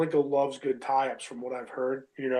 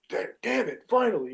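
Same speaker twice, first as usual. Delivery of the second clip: imitating an angry ref, pitched up; mock exasperation, higher than usual; mad ref; 8 semitones higher than usual. Ref fed up after being ignored, retold as mad. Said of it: "and he was mad".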